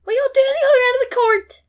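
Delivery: very high, loud, fast yell at the players; screaming coaching. Retold or imitated. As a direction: scream orders across the court. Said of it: "screaming advice at them".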